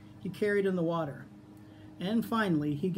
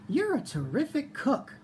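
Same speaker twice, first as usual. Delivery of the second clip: high piglet voice, full of praise; 10 semitones higher than usual. A narrator's reading. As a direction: compliment the fox in a high small-pig voice. Piglet praising the fox in story.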